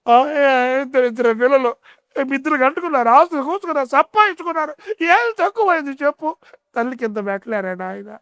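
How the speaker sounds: put-on angry old mother. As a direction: scold the pastor like a wronged old mother. Old mother complains again.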